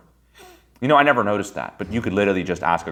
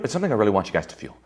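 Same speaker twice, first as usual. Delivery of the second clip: ordinary delivery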